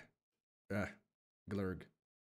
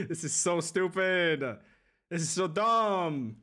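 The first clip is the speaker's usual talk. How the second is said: loud, high exasperated shout; frustrated yell; 7 semitones higher, 10 dB louder than usual. Real, not acted. Streamer yelling at the game in frustration. Repeats louder and higher.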